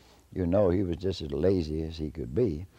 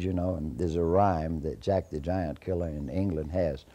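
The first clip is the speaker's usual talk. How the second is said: ordinary delivery, nothing marked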